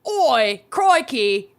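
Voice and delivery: mock Aussie "Oi, crikey"; playful put-on Australian twang; Australian accent.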